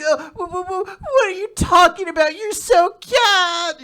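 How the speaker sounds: very high stammering squeal, rising to a loud wail; hammed-up embarrassed shriek, dragged out at the end; flustered Hermit. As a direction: squeal it like a mortified girl. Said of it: "Hermit starts fucking blushing".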